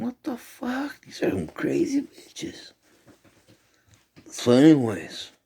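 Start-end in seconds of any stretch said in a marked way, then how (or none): 0.0-2.7 very high, hushed squeal; retold disbelief. Reacting to a cord pulled from the throat, squeaky mock disbelief.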